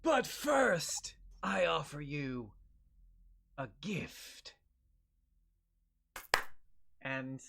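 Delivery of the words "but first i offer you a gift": villain voice. Acted. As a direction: grandly offer the gift as a villain.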